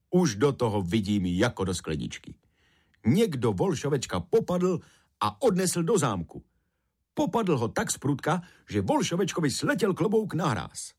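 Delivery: lifted, animated storybook character voice; Rumcajs, keen and sure. A narrator's reading. Rumcajs explaining the kidnapping to Cipísek, keen and sure. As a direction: work out the mystery aloud, pitch climbing.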